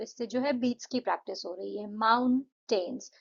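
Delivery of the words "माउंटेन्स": माउंटेन्स said slowly in two clapped beats; clapping beats. Shown as a demonstration. Teacher claps माउंटेन्स to show two syllables. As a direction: clap out माउंटेन्स, one clap per syllable. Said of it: "दो बार ताली बजाई".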